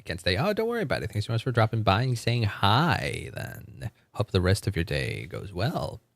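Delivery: usual speaking voice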